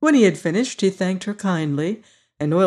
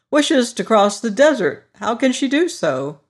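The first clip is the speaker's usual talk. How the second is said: higher, louder cheerful straw man; Scarecrow voice; 5 semitones higher, 4 dB louder than usual. Acted. Scarecrow boasting to his friends, high and loud. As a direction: play the proud new ruler, brighter and up.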